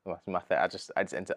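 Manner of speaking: ordinary talk, nothing marked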